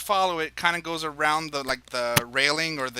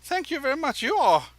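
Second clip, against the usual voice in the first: much higher, fluttery dandy voice; flattered dandy; 11 semitones higher, 5 dB louder than usual. Acted. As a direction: gush like a flattered fop.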